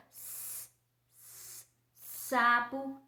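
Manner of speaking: "S" hissed without voice, then a slow "sapo"; hissing S. Shown as a demonstration. Teacher showing kids how the S hisses. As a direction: hiss the "S" out. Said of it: "pra falar o S, a gente chia".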